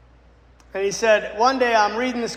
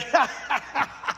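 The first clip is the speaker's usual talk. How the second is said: high cackle of scorn; mocking demon laugh; 5 semitones higher, 4 dB quieter than usual.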